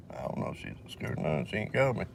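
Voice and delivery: an off-topic aside, whispered to himself. Real, not acted. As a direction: mutter it to yourself under your breath. Aside to himself about someone.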